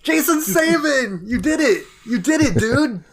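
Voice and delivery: high, loud cheering with a laugh; excited cheer. Real, not acted. Cheering his friend's pun on the show. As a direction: celebrate it like a big win.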